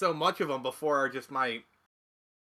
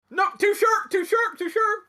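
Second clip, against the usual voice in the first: high, louder pained yelp; pained squeal; 6 dB louder than usual. Retold or imitated. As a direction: squeal it like the cheese burns. Acted pained reaction to cheddar.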